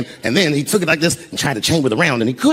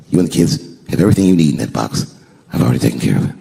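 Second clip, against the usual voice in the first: acted reassurance; 7 semitones lower than usual. Husband handing safe deposit keys to his wife, acted reassurance. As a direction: soothe her gravely, pitched right down.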